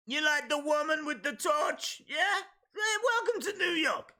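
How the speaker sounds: high, pushy New York accent; hammed-up New Yorker hawking the city; shouty high-pitched mock New York accent. Shown as a demonstration. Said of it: "let me just say this again".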